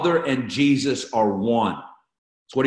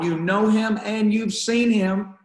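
excited Jesus voice; 8 semitones higher than usual. Acted. Jesus voiced, pitch jumps high.

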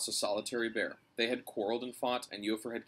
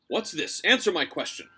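demanding king; 6 semitones higher, 7 dB louder than usual. A narrator's reading. Bear king demands an answer.

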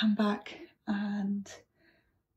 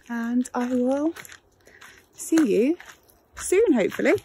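sing-song goodbye; 5 semitones higher, 3 dB louder than usual. Real, not acted. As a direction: sing it out cheerily.

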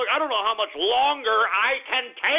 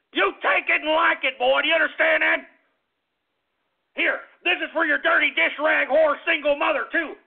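higher-pitched threatening country father; angry daddy; 5 semitones higher than usual. Pretend. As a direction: threaten the boy like an irate daddy. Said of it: "if I was your daddy".

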